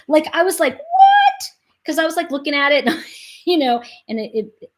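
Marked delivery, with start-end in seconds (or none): 0.8-1.4 high, loud disbelieving what; astonished. Retold or imitated. Daughter re-enacting finding dad in a war book, astonished. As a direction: re-enact your amazement with a leap in pitch. Stunned reaction to book find.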